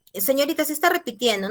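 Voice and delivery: lightly voiced pupil querying the teacher; student objecting. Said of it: "No se está repitiendo".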